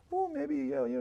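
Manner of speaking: put-on a light, half-hearted Indian accent on the driver's words; hint at the driver's Indian accent, then give up. Narrator quoting his Indian driver, faint Indian accent. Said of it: "he's, with his accent, I can't do it".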